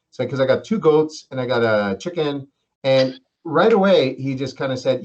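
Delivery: normal talking voice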